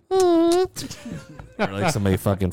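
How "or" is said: very high squeak; squeaky imitated noise. Mimics an absurd noise in silence.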